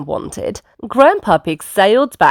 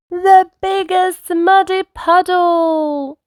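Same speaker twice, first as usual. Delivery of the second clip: slow, high storyteller reveal; excited reveal; 12 semitones higher, slower than usual. A narrator's reading. Narrator revealing the puddle, slow high excitement. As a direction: stretch it out in high delight.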